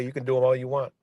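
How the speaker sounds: ordinary talk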